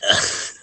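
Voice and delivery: giggling, unvoiced; can't hold the laugh in; breathy laugh. Real, not acted. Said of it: "I'm laughing too much".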